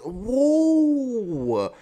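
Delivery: sudden high whoa of surprise; a stunned gasping whoa; shocked whoa. Real, not acted. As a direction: blurt it out in real shock. Shocked reaction to the reveal.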